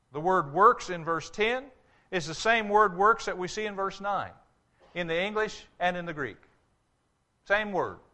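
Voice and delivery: ordinary talk, nothing marked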